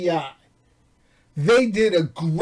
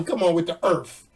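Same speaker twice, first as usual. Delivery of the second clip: normal talking voice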